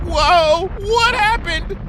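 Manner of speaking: high, loud shriek of alarm. A narrator's reading. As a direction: shriek it out in panic. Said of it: "Bon Bon shrieked".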